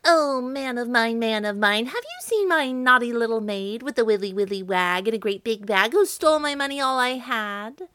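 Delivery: hammed-up witch calling out angrily; angry witch calling. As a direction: demand an answer from the baker like a furious witch. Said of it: "calling angrily".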